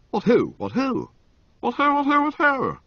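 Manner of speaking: high, bouncy repeated greeting; a merry young toff chirping away; chirpy young lord. A narrator's reading. Motty greeting Bertie in bed, high and chirpy.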